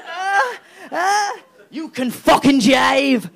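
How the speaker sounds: high, strained crying wail, slow; mock wailing. Theresa May wailing while the tree mocks her dancing. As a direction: wail it out like a breaking voice. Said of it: "She's going".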